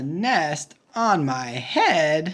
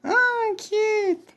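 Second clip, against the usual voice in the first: cooing. Acted.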